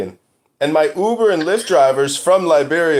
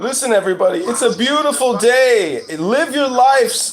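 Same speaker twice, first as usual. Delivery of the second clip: mock proclamation; 5 semitones higher than usual. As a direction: proclaim it to the crowd, stretching each phrase. Mock speech addressed to everybody.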